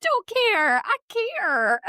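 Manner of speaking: high, loud, mock shrug then flip; playfully admitting she cares; hammed-up denial; mock nonchalance. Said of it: "I was like".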